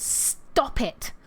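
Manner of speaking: "Stop it." with a drawn-out, hissed s and a soft, voiceless delivery; "Stop" starts on a long hiss, then a quiet, breathy "it". Retold or imitated. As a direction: lean on the s of "Stop" and whisper "it". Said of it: "she has an emphasis on the s- sounds".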